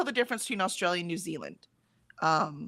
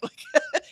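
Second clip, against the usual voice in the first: quick laugh. Real, not acted. Laughs at the Picard comparison.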